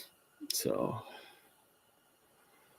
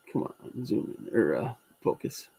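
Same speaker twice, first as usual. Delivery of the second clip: muttering to himself; 2 semitones lower, 8 dB quieter than usual. Real, not acted. Fiddling with camera zoom.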